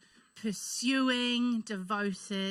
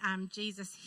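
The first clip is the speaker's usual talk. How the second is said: ordinary delivery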